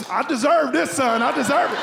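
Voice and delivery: mildly hammed-up righteous old dad; indignant dad. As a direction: insist on it to your son. Acting his dad at the fountain.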